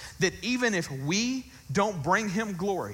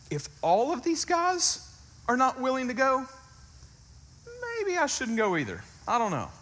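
doubting soldier's thought; 8 semitones higher than usual. Pretend. Imagined doubter's thought, voiced.

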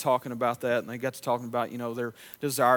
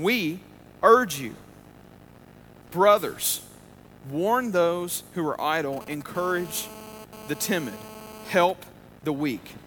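slow, emphatic scripture reading; deliberate reading of the verse; 2 semitones higher, much slower than usual. Acted. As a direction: give each phrase weight and pause. Rereads scripture slowly.

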